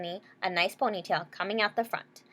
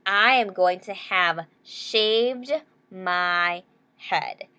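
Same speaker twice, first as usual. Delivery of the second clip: slow, drawn-out threat ending in a breath; a dramatic slow warning; 3 dB louder, much slower than usual. Acted. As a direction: spell it out word by word.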